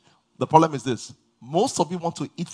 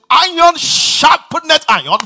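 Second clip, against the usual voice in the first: slow, higher reading of Iron sharpeneth iron; scripture, slowly stressed; 11 semitones higher, 10 dB louder, much slower than usual. Acted. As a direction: lean on each word of the verse. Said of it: "He said".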